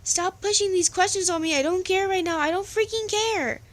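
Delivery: high, loud acted outburst; angry yelling. A narrator's reading. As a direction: explode at a pushy friend, pitch climbing. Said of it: "Jack yelled".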